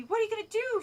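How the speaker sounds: asked out of breath, high; breathless. A narrator's reading. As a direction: gasp the question out. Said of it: "she asked breath-, breathlessly".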